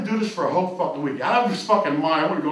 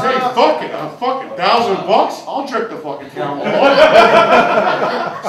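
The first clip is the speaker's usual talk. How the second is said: loud resolve; 4 semitones higher, 8 dB louder, slower than usual. Retold or imitated. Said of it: "So he says".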